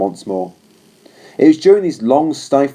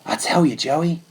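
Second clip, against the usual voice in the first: put-on higher, earnest young soldier's voice; young trooper confiding; 4 semitones higher, 4 dB quieter than usual. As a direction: speak it gently to the horse as a friend. Warren confides in his horse.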